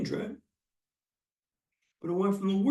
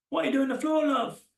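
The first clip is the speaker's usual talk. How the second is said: higher-pitched imitated British nurse; British accent; 5 semitones higher than usual. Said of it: "That's a fantastic British accent".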